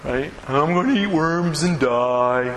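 slow, mopey Eeyore voice; gloomy Eeyore. Retold or imitated. Preacher voicing Eeyore for the congregation, slow and mopey. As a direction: drag it out like sad Eeyore. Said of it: "Don't be an Eeyore".

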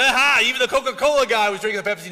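quick, loud, gleeful jab at the driver; loud quick mocking gotcha; mock gloating bystander. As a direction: crow it like a caught-you joke. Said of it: "And said".

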